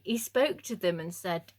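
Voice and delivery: ordinary talk, nothing marked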